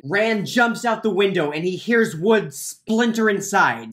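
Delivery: normal talking voice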